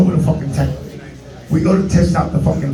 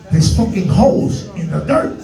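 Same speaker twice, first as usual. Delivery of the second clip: usual speaking voice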